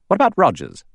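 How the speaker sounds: high, sudden question; challenging Lombard. A narrator's reading. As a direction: throw the question out, voice jumping up.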